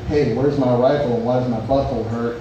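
ordinary talk, nothing marked

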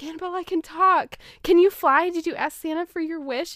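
put-on high, loud eager kid; calling out with joy; excited boy. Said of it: "And he's like".

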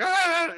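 sudden high-pitched wrestler war cry; mock Ultimate Warrior battle cry; Ultimate Warrior yell. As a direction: let out a short wild wrestling scream. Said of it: "and then he's like".

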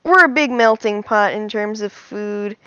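ordinary talk, nothing marked

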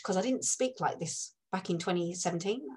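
ordinary delivery

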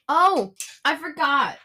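ordinary delivery

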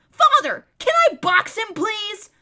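put-on high, loud boyish plea; eager shout. Corin begging his father to let him box, shouting. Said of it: "and Corin shouted".

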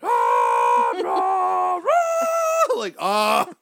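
loud, high mock roaring; monster roar. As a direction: roar like costumed demons at the door. Acted Satanists at the door.

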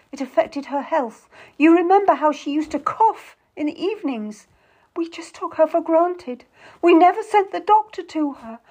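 high, building lament; distressed. A narrator's reading. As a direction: plead tearfully, swelling towards the end. Histrionic sister pleads for sick nurse.

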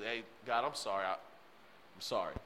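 voicing Hezekiah's meek, sorry plea to God; humble apology. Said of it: "Hezekiah humbled himself before the Lord and said".

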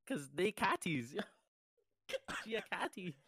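hammed-up Toronto accent, high and laughing. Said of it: "give us your best Toronto accent".

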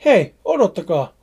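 loud plea to retreating figures; shouting after them. Acted. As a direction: call them back before they vanish.

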